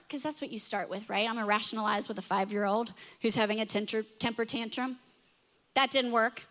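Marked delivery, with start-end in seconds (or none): none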